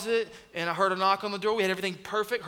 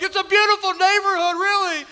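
much higher, much louder cover-up pitch; forced cheer over the screaming; 12 semitones higher, 10 dB louder than usual. Retold or imitated. Parents talking up the neighborhood to a buyer, loud forced cheer. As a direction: sell it loudly while the kid howls. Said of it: "We're like".

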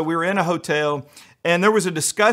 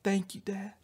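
put-on small girl voice; 4 semitones higher, 12 dB quieter than usual. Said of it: "She's like".

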